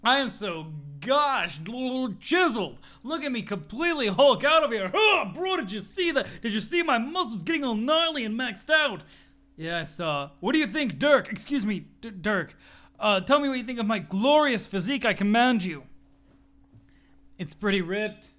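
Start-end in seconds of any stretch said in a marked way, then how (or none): 0.0-9.0 boastful gym bro showing off; a lightly voiced bragging bodybuilder; muscle bro. Muscle bro to his friend Dirk, lightly voiced boasting. 10.4-15.8 boastful gym bro showing off; a lightly voiced bragging bodybuilder; muscle bro. Muscle bro to his friend Dirk, lightly voiced boasting.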